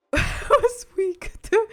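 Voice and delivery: loud, high laughing while talking; helpless laughter. Real, not acted. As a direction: squeeze slow words out between laughs. Cracks up reading a chat message.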